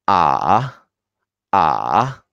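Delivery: long "Ah" dipping low, then rising; low tone three. Shown as a demonstration. Teacher reviews tone three, long low dipping "Ah". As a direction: sink "Ah" into the chest, then come up. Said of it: "It's much lower".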